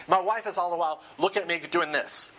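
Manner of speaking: usual speaking voice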